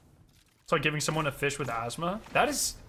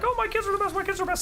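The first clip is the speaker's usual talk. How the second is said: much higher, sing-song mock of a doting parent; mocking proud parent; much faster than usual. Coworker bragging about kids, mocked in a high sing-song. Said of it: "when coworkers are like".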